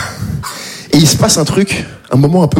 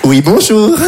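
polite greeting; much slower than usual. Retold or imitated. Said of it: "Vous voyez ce rire-là?".

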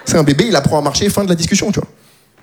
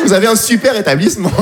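ordinary talk, nothing marked

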